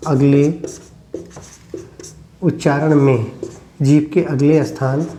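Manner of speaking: word by word with long pauses; dictation. Real, not acted. Teacher dictating a definition to the class, word by word.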